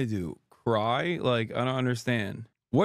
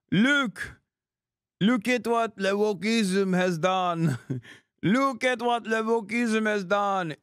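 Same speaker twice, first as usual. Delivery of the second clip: high, whiny mock French accent with laughs; hammed-up French crybaby begging Macron to save him; 8 semitones higher, slower than usual. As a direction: play a wailing French victim, pitched way up. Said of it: "the French Beitar?".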